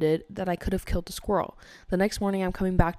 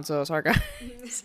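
slow mock apology; 4 semitones higher, much slower than usual.

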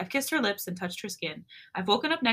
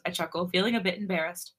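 plain reading voice

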